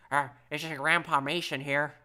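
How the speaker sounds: grandpa voice. Pretend. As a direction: play a doddering grandpa, slowly. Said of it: "Do the grandpa voice".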